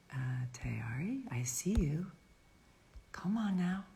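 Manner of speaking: hushed whisper while waiting; soft unvoiced whisper to herself. Real, not acted. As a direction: whisper it under your breath, impatient. Quietly waiting for guest to join.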